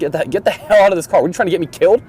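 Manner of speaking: high-pitched imitation of an irate examiner; retold yelling. Examiner throws driver out of test, imitated yelling. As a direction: imitate a furious telling-off, pitched well up. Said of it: "It's, like, yelling at me".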